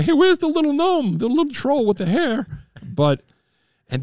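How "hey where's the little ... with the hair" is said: mock Messier greeting. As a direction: lightly imitate him calling you a gnome. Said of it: "I wanna say".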